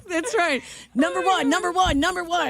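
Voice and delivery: high chanted number one; mock cheer.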